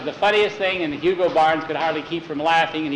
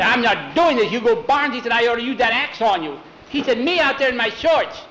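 higher, indignant mock anger; mad outburst; 6 semitones higher than usual. Skeeter mad at Hugo Barnes backstage, indignant outburst. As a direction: retell it as indignant protest. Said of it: "Skeeter was mad as he, as he could be".

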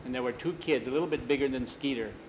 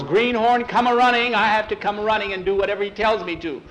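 louder, higher bossy call; mock yell; 6 semitones higher, 6 dB louder than usual. Bran Face imitates Hugo yelling for his greenhorn, louder and higher. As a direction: play the yelling upperclassman. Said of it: "when he yells".

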